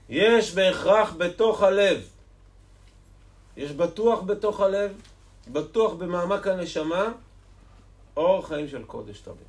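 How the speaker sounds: loud, high, insistent conviction; impassioned preaching. Acted. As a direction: drive it home to the congregation.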